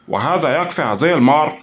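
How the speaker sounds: lightly gloating farewell; mocking sign-off. A narrator's reading. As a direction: end the call with a sneer. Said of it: "ابتسامة ساخرة وهو يضيف".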